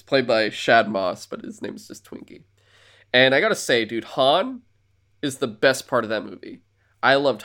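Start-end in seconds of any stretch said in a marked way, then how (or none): 1.3-2.4 breathy whisper under the breath; whispered aside about the name Twinkie. Real, not acted.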